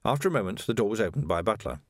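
ordinary delivery, nothing marked

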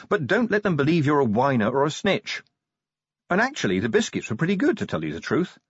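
lightly voiced sergeant, lifted then dropping; brisk sergeant. Sergeant advising new recruit on pranks, brisk and practical. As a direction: practical advice ending in a confiding drop.

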